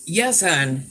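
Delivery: usual speaking voice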